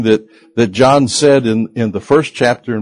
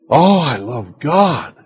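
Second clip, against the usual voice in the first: gushing devotion; 4 semitones higher, slower than usual. Acted. Preacher mimics hypocritical believer.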